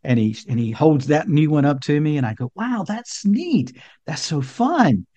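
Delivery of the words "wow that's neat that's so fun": put-on slow, higher-pitched wonder for a toddler; dad cooing to child. Dad bragging on his son's new gift, cooing. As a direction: draw out the praise for a little boy.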